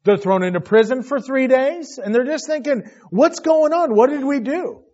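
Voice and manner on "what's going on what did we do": high, loud bewildered cry of confused brothers; panicked bewilderment. Retold or imitated. Brothers panicking in prison, high bewildered cry. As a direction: voice their panic, pitched high.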